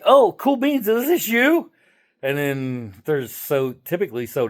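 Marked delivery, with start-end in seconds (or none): 0.1-1.7 mock innocent. Trolling reply to texter.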